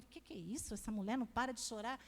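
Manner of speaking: low-key, soft aside as a puzzled husband; muttering husband. Retold or imitated. Husband puzzled by his crying wife, muttered aside. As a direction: quote him quickly under your breath.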